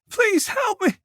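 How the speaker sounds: high, slow, desperate shout; trapped girl crying out; shouting for help. A narrator's reading. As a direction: scream for daddy to save her. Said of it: "Colette shouted".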